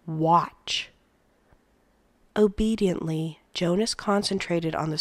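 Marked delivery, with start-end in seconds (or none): 0.1-0.9 a strange, firm order; stern command. A narrator's reading. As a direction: command him to watch. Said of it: "commanded in a strange voice".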